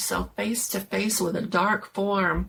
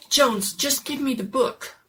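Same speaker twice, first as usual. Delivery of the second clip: urgent demand; 6 semitones higher, 4 dB louder than usual. Acted.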